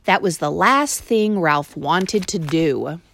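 usual speaking voice

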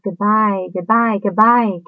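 high, slow calls fading away; distant goodbyes. A narrator's reading. Far-off spiders calling goodbye to Wilbur. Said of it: "came weakly to Wilbur's ears".

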